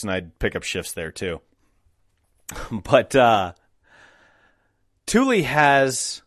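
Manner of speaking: ordinary talk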